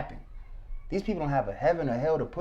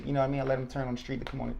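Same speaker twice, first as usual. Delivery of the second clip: ordinary talk, nothing marked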